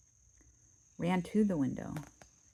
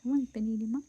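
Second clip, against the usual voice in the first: higher, louder mama bear cry; 6 semitones higher, 5 dB louder, faster than usual. Acted. Mama Bear finding her porridge eaten, higher voice. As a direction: lift it up into a high voice. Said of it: "Is it a high voice?".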